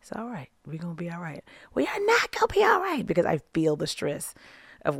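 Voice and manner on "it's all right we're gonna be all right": soft, hushed reassurance; calm her down in a near whisper; husband soothing his panicking wife, hushed. Retold or imitated. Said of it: "my dear husband ... looking at me like".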